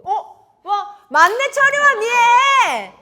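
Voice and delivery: shrieking in delight, high and loud; shrieking jackpot winner. Real, not acted. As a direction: scream the name like a jackpot win. Recognizing the group.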